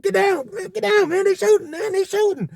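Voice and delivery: high frantic "get down, man"; panicked uncle. Retold or imitated. Uncle Elroy on all fours warning the nephews, high and panicked. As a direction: cry out like a scared uncle crawling.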